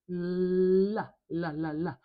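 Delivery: "La" with a long held L, then quick repeats; teacher isolating the L in "la"; demonstrated L sound. Shown as a demonstration. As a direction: lean on the L in "La", then "la, la, la". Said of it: "There is the L sound".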